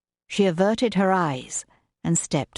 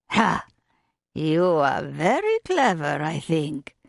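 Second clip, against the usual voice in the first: put-on French accent. A narrator's reading. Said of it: "with that strong French accent".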